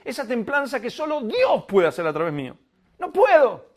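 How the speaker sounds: impassioned preacher crying out his helplessness; preaching at full force. Acted. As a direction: build it up until you break out. Confession builds to a cry.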